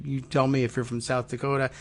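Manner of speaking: ordinary talk, nothing marked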